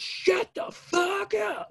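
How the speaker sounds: very high, slow imitated outburst; drawn-out squawk. Friend silencing the party bus for a phone call, high drawn-out mock yell.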